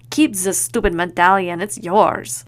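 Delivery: huffy line in a hammed-up French accent; bad French accent. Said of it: "Horrible French accent and all".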